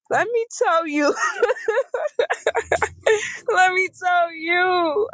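high-pitched laughing through words; cracking up while trying to talk. Real, not acted. Laughing fit while starting story.

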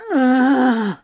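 loud exasperated groan; frustrated groan. A narrator's reading. Crowley groaning loudly at the teasing. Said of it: "Crowley groaned".

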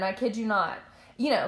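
ordinary talk, nothing marked

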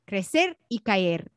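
ordinary talk

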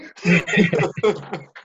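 giggling after the joke; high laugh. Real, not acted. Laughing at own impression.

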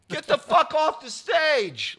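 high, loud heckling yell; balcony heckler. Retold or imitated. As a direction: imitate an angry shout from the balcony. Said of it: "one voice in the balcony go".

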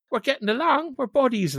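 high-pitched, chummy delight; giddy mock excitement. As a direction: gush as if you've made a new best pal. Mock glee at bonding with Keane.

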